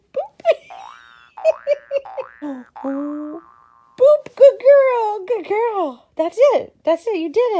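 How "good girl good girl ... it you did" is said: high, loud praise voice; talking to a dog. Real, not acted. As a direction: cheer on the dog. Praising dog after trick.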